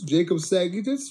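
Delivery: usual speaking voice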